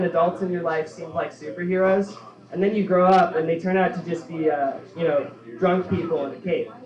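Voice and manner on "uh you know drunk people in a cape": ordinary delivery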